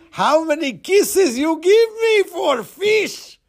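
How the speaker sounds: high, slow shouted fishmonger voice. Acted. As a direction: crow it mockingly as the old fishmonger. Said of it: "in the same voice as he used for the fishmonger".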